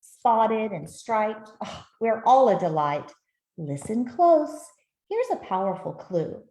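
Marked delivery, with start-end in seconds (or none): none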